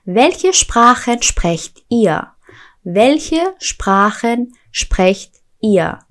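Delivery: slow, careful German question; slow model question. Shown as a demonstration. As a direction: ask it slowly for learners. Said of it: "slow German model".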